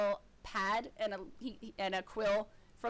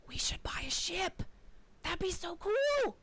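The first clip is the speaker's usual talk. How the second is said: conspiratorial whisper; 7 semitones higher than usual. Acted. Drunk guard leaning in to Loeb, hushed whisper. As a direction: lean in and whisper the dream. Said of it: "He's like".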